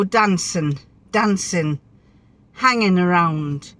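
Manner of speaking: "dancing" and "hanging" ending in "in"; dropped G. Retold or imitated. Wife imitates friend Barbara, "dancing" without the G. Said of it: "She misses the G".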